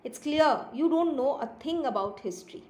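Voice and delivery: slow, lilting storybook reading; story read-aloud. A narrator's reading. Teacher reading a kitten story to pupils, slow expressive narration. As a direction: read slowly with emphatic pitch swoops on key words.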